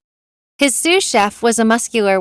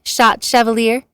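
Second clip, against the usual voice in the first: ordinary delivery, nothing marked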